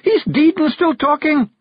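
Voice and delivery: very high, loud, drawn-out cry; shout. A narrator's reading. Jeanie shouts down the hole about Rob, alarmed shout. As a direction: yell the alarming news slowly, way up high. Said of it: "Jeanie shouted down the hole".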